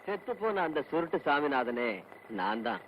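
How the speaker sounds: measured line dropping very low; ominous reveal. Acted. Stranger reveals he is the dead man, ominous reveal. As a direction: sink low on the final words.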